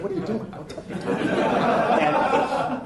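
high, hoarse mock scold of a strict dean; imitating the dean. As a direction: play the fussy dean telling him off. Dean of students voiced, scolding.

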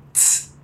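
soft hissed tss; a short soft tss, tongue on teeth; ts sound. Shown as a demonstration. Teacher drills the lone ts sound for students. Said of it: "Primeiro o som sozinho".

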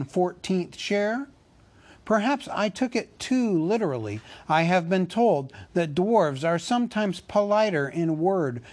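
a hobbit standing his ground, hurt; nervous hobbit Bilbo. A narrator's reading.

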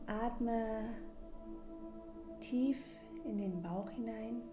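calm breathing guide, gently paced; guided meditation. Acted. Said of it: "in diese kleine Mini-Meditation".

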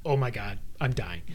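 low, quiet, subdued aside; understated retelling. Retold or imitated. Climber recalling the one scare, low and understated. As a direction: drop low and soft, no panic.

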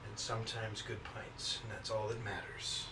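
hushed mock ceremonial tone; solemn regal knighting. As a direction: dub him like a playful king.

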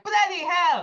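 high, loud fake-British exclamation; cheeky, exaggerated British impression; mock British accent. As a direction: blurt it in an obnoxious British accent. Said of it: "making fun of the accent".

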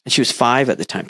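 ordinary delivery

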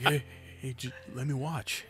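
a hushed creepy coaxing; creepy pleading voice. Retold or imitated. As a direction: coax quietly like a creep.

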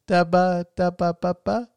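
put-on high, sing-song baby babble; playful ba-ba baby talk; baby babble imitation. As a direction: babble like an infant. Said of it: "I, like, impersonate her".

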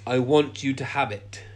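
ordinary talk

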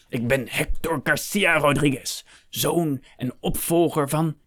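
high, grand self-announcement; proud, drawn-out declaiming; haughty proclamation. A narrator's reading. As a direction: announce your title with swelling pride. Nobleman proclaims his name and rank.

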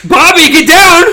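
high, loud mock yell at a child. Mother yelling at her son Bobby in the tree, mock yell. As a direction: holler the kid's name down from the tree. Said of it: "a woman yelling at her child".

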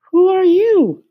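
very high, sing-song cricket asking who's there; high musical cricket. Acted. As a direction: squeak it up high like a tiny cricket. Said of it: "He had a high musical voice".

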